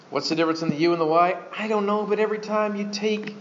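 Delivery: normal talking voice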